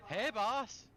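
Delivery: calling out loud and high across a room; background shout. Acted. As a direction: yell from the back of the room. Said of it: "somebody shouting in the background".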